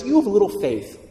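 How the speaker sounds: high, softening rebuke of Jesus; gentle rebuke from Jesus. Retold or imitated. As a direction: chide a friend tenderly. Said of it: "the tone there is everything".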